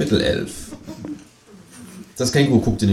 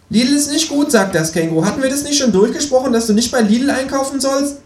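much higher kangaroo voice, scolding. A narrator's reading.

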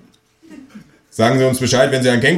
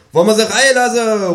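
high call into the flat; 13 semitones higher, 4 dB louder than usual. A narrator's reading. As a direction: call loudly to someone inside. Calling into the flat.

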